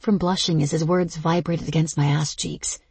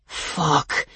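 hissed. Acted. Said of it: "he hissed".

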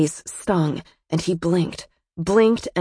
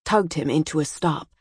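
ordinary delivery, nothing marked

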